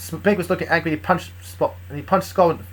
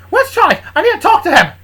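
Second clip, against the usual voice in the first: high, loud demand; urgent call; 9 dB louder than usual. Acted. Moxie bursting in looking for Charlie, urgent shout.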